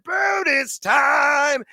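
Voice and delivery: stretched, high announcer yell; announcer shout. Acted. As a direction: bellow it like a game-show host. Segment intro announcement shout.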